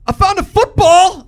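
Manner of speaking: very high, loud dog voice; over-the-top high dog announcement; excited drug dog. Acted. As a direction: yelp it like a dim, delighted dog. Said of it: "the dog was like".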